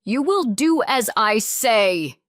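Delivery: shouted. A narrator's reading. Father locking up daughter, furious shout. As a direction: roar the order. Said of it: "Aaron shouted".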